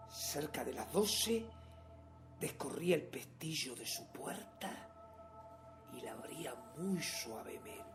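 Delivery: low, very soft storytelling; near-whispered, secretive confession; hushed stealth. Acted. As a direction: tell it as if creeping through a sleeper's door. Recounts stealthy creeping into room.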